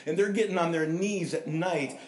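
usual speaking voice

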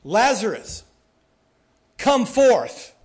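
loud, high commanding call; preacher acting out a booming summons. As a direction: call it out with authority, pausing before the command. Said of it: "in a loud voice".

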